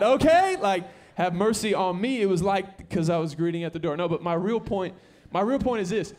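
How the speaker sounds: loud, high, driving sermon voice; full-force pulpit preaching; fired-up preaching. Acted. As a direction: preach it hard to the room.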